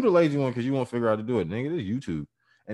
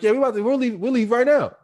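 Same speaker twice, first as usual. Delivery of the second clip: much higher, louder retelling voice; mock loud insistence; 8 dB louder than usual. Movers promising the customer they'll leave, high mock insistence. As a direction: insist over the top that you're going. Acted insistence while truck won't start.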